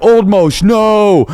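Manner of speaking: high, loud pleading cry of the name; mock wife begging him to stop; wife crying out in alarm. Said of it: "Natasha's like".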